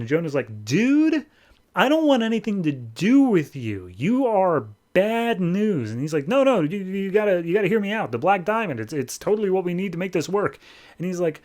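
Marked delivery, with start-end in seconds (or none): 0.6-5.9 high-pitched, drawn-out mock exasperation; retold weary refusal in a raised voice; exasperated rejection. Jonah acted out rejecting Gordon. 6.3-10.6 high-pitched, stumbling retold plea; urgent mock pleading in a raised pitch; insistent pleading. Gordon acted out pleading to Jonah.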